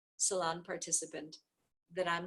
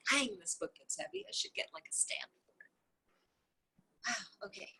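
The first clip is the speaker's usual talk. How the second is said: hushed, breathy aside to herself; soft whisper, losing her place in the book; 6 dB quieter than usual. Real, not acted. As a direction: mutter it under your breath while finding the place. Reader's muttered aside about heavy book.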